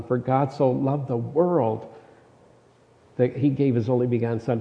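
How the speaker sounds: "world" stretched out, with a pause after it; stress on WORLD. Shown as a demonstration. Preacher re-reads the verse, stress on "world". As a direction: land on "world", pause, then finish briskly. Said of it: "with the emphasis on a different word".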